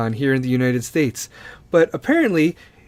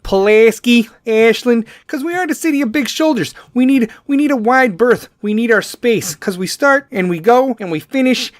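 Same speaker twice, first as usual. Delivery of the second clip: loud, high-pitched hammed-up Chicago accent; 7 semitones higher, 5 dB louder than usual. Flamethrower man answering a reporter, thick Chicago accent. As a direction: play a proud Chicago local with a thick accent. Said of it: "I'm not too bad with the Chicago accent".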